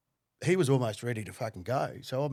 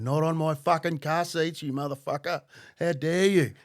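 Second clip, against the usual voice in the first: loud, higher indignant telling-off; outraged scolding; 4 semitones higher, 5 dB louder than usual. Retold or imitated. Driver to woman retching on his car seats, outraged scolding.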